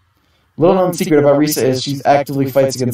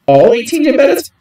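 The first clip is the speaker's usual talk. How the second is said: astonished; 10 semitones higher than usual. Acted. Player hearing the tournament prize, high astonished question. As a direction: gasp at the huge prize.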